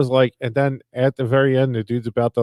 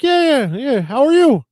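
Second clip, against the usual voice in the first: much higher, louder, overly bright hello; fake cheery phone greeting; 13 semitones higher, 6 dB louder than usual. Answering spam call on speakerphone, fake cheery greeting. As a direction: greet the spam caller like an old friend. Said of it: "And I'm like".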